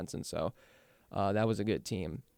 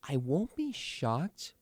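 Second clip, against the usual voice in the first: ordinary talk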